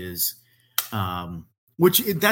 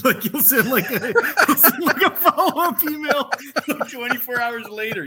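high, loud words broken by laughter; cracking up mid-sentence; laughing; 7 dB louder than usual. Real, not acted. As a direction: laugh through the words as you say them. Laughing while telling story.

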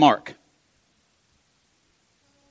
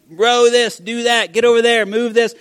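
higher, mildly yelled orders; mock yelling; 6 semitones higher than usual. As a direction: imitate crew yelling at each other. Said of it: "They're probably yelling at each other".

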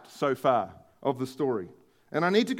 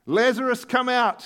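raised, commanding call; shouting; 6 semitones higher, 5 dB louder than usual. A narrator's reading. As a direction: call him out of the grave. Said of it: "he shouted".

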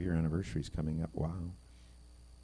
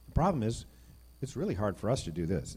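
ordinary talk

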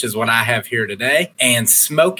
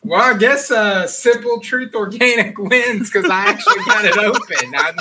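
high laughing announcement; 9 semitones higher than usual. Real, not acted. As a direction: crack up while declaring it. Laughs while declaring winner.

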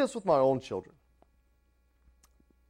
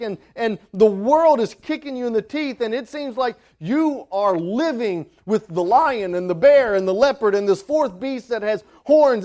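higher, louder full-force sermon; preaching; 9 semitones higher, 5 dB louder than usual. Acted. Pastor preaching Daniel to the church, at full force. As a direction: thunder the message out to the church.

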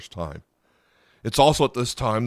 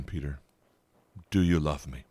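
much softer, nearly hushed, the plea repeated; softer gentle Jesus; 6 semitones lower, 4 dB quieter than usual. Retold or imitated. Jesus gently asks broken Peter again, hushed and tender. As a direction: ask it again quietly, tenderly. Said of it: "I'll ask that again".

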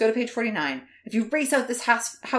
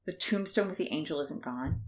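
ordinary delivery

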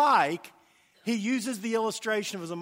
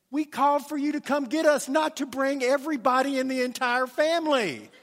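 higher, exasperated half-joking protest; exasperated complaint; 5 semitones higher than usual. Retold or imitated. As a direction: complain that everyone showed up. Nephew exasperated at whole family.

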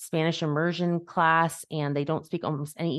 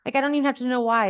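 put-on relieved child; 6 semitones higher, 3 dB louder than usual. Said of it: "he was like".

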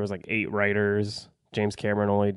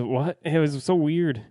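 ordinary talk, nothing marked

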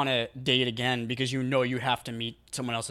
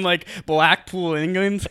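mock British accent; 5 semitones higher, 4 dB louder, slower than usual.

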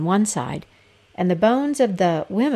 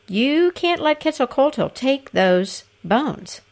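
scheming lord voice; 7 semitones higher, slower than usual. A narrator's reading. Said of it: "But privately, he said to his servants".

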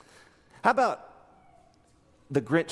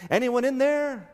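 lightly voiced Charlie Brown, higher, hopeful call; 8 semitones higher, 3 dB louder than usual. Pastor voicing Charlie Brown at the empty mailbox, hopeful call. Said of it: "he goes to the mailbox and he says".

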